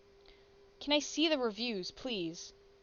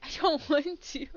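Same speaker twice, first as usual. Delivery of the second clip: laughing; 4 semitones higher, 4 dB louder than usual. Real, not acted. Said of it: "Don't hurt Momo".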